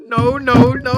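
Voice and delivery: loud mock-outraged yelling; hammed-up repeated refusal; imitated tantrum. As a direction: yell it like an old man throwing a fit. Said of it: "Banging on his cart".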